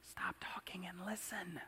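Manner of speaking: hushed voice sinking into a whisper. Acted. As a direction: whisper it as a gentle call for attention. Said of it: "He lowers his voice and says".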